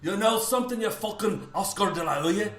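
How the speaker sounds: put-on rough Irish brogue, Irish accent, threatening and fast; Irish accent, a brawler squaring up for a fight; mock Irish accent. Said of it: "I'm fucking doing my Irish".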